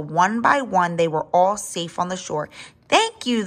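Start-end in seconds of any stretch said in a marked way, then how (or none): 2.9-3.4 high, mildly raised call; shouted thanks. A narrator's reading. Father dinosaur waving to the long neck, shouting lightly. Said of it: "the father dinosaur shouted".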